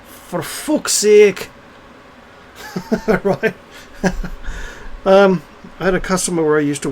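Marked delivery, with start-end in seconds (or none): 0.1-1.5 very slow, drawn-out Geordie; Geordie accent. Retold or imitated. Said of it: "Geordie mates would say". 2.6-3.2 short higher-pitched chuckle; laugh. Real, not acted. Amused at own Geordie line.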